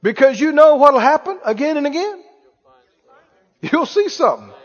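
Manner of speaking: ordinary delivery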